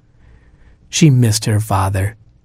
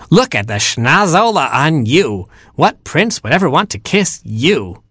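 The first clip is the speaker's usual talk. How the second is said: taunting stepsister; 5 semitones higher than usual. Acted.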